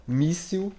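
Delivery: míssil with the first syllable mí punched high; míssil, hit the mí hard; stress on MÍ. Shown as a demonstration. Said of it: "je dois mettre míssil la plus forte". (míssil, syllabe mí accentuée)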